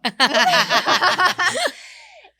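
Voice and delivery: bursts into a long, loud, high laugh. Real, not acted. Riso após piada da produção. (gargalhada alta e aguda)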